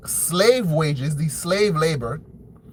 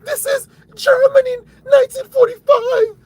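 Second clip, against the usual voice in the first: very high, loud whiny shriek; mock victim wail; 7 dB louder than usual. Commentator parodies protesters playing the victim, shrill mock wailing. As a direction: wail in hammed-up falsetto outrage. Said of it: "So then now all of a sudden".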